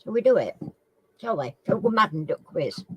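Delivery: ordinary talk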